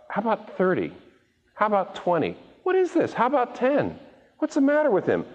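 a fed-up God throwing up his hands; God exasperated by Abraham's bargaining, incredulous and high-pitched. Acted. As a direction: pitch climbs high in disbelief.

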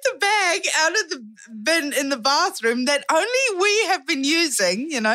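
high-pitched, laughing storytelling; laughing in mortification. Real, not acted. Storyteller reliving the knickers being unpacked, laughing in mortification. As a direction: keep telling it through rising laughter.

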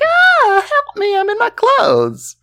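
very high falsetto cry for help; mock partygoer. Partygoer fallen in the pool, mock falsetto cry. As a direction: squeal like a silly partygoer. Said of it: "party people dicking around".

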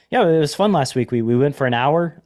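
ordinary talk, nothing marked

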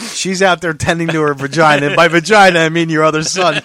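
loud, high mocking voice breaking into laughter; mock laughing insult. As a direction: laugh through the roast. Mock reply continued, laughing.

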